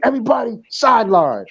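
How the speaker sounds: mock coach yell. Coach Ian yelling at players.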